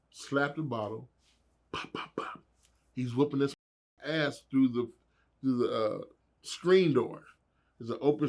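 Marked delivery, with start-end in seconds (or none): 1.7-2.4 breathy imitated punches; punch sounds. Imitating the punches landing, breathy mouth sounds. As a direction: tap out the blows with the mouth.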